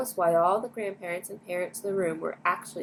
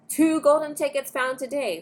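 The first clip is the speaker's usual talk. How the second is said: louder and higher, announcing; headline shout; 8 semitones higher, 5 dB louder than usual. A narrator's reading. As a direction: read it like a blaring headline. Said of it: "screamed the headlines".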